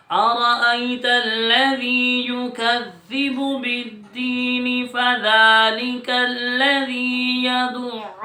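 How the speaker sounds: sung Quran recitation, slow and drawn out; hadr recitation. Shown as a demonstration. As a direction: recite the whole sura melodically. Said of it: "اب میں حدر میں آپ کے سامنے پڑھوں گا". (حدر میں تلاوت)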